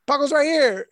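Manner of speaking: high-pitched bystander's announcement; imitated calling out. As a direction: call it out in a mimicked high voice.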